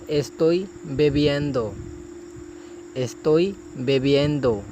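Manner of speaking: slow, careful Spanish, each syllable clear; slow model phrase. Shown as a demonstration. Teacher modelling a Spanish phrase for students, slow and careful. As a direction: model it slowly for learners. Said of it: "تو آگے".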